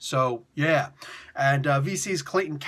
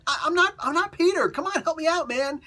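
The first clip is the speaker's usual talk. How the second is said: high, pleading shout that trails off; half-yelled plea for help; mock pleading yell; 13 semitones higher than usual. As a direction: Miles yelling back at the symbiote while falling. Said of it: "they're yelling at each other".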